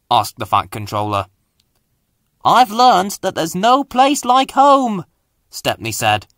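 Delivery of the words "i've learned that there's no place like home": contented. Acted. Stepney sums up lesson.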